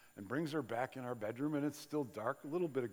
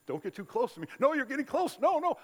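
mock fearful push-away; 10 semitones higher, 6 dB louder than usual. Acting out avoidant attachment.

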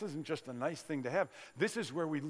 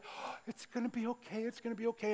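put-on soothing a child; 8 semitones higher, 3 dB quieter than usual. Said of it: "you say".

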